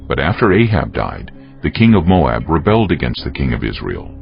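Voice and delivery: ordinary talk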